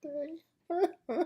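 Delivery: high, slow laugh-cry imitation; retelling his own tearful interview, high and slow through laughter; mock crying. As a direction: imitate your teary self while laughing at it. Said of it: "I said".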